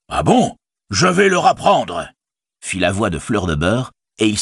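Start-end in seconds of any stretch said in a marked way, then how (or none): 0.1-2.1 high, drawn-out innkeeper's threat; angry threat. A narrator's reading. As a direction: call out a slow, high-pitched angry warning. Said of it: "la colère noire sur son visage". (menace coléreuse, aiguë et traînante)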